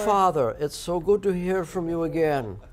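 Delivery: hammed-up formal son greeting father; mock posh, slow and proper. Son answering his dad's call, mock formal and proper. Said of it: "do you say".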